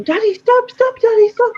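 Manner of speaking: high, loud mock child's cry; little girl shouting. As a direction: imitate a panicking little girl calling to her dad. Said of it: "his little girl started shouting".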